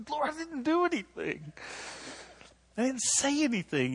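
high whining "I didn't do anything"; whiny protest to God. Retold or imitated. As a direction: play the wronged child complaining to the Lord. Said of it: "I was like".

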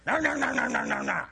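high, nagging string of no's; whiny scolding, hammed up; mock fussing. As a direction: imitate a preacher fussing at people. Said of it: "He never fussed".

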